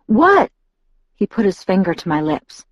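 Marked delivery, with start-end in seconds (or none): 0.1-0.5 startled, high-pitched question; sharp with shock. A narrator's reading. Bella questions Edward in alarm, sharp with shock. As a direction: blurt it out in alarm. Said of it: "My voice was sharp with shock".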